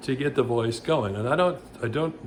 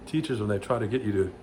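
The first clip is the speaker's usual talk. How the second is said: ordinary delivery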